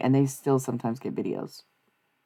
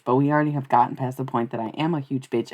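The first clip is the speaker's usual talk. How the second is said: ordinary talk, nothing marked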